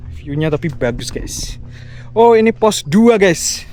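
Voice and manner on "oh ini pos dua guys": excited, high and loud discovery; light up at reaching the checkpoint; surprised. Real, not acted. Arriving at checkpoint two.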